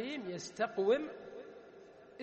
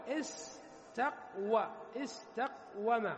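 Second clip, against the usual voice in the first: slow syllable breakdown; much slower than usual. Shown as a demonstration. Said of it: "فنقول". (نطق استقوم ببطء مقطعًا مقطعًا)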